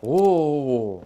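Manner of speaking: long, loud oh of wonder; awed exclamation. Real, not acted. Player marvels at the magnificent hand, awed exclamation. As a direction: let out a drawn-out oh.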